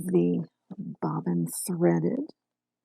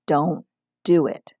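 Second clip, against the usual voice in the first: louder, firm warning; stern warning; 7 dB louder, slower than usual. Real, not acted. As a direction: warn them firmly.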